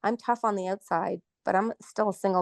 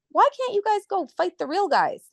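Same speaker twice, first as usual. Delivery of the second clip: mocking challenge; 14 semitones higher than usual. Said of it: "I actually said that to them".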